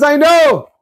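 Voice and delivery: high, shouted triumphant revelation; high exclaiming voice, shouting that it was him; new husband's reveal. Retold or imitated. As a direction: proclaim it like the beggar who came out on top. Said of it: "And the new husband says".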